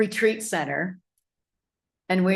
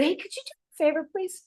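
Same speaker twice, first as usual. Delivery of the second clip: higher, fast, overly sweet request; butter her up with a high, fast favor ask; sweet polite ask; 9 semitones higher, 3 dB quieter, faster than usual. Retold or imitated.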